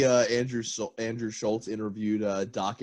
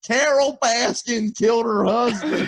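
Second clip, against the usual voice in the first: higher, louder laughing mock yell; mock screaming; 12 semitones higher, 6 dB louder than usual. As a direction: shout it like a rowdy boater. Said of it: "business is just".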